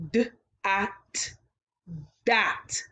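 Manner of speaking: segmented D, ah, t then blended into dot; sound blending. Shown as a demonstration. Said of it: "change the t to a d".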